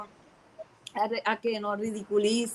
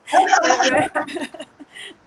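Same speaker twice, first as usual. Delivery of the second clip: real laugh; 6 semitones higher, 4 dB louder than usual. Real, not acted. Recalling the shortest Sunday school ever.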